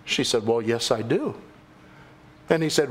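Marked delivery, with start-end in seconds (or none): 0.5-1.4 put-on low, unruffled old lady's reply; old saintly lady. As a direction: answer simply in a much lower voice. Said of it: "the old saintly lady".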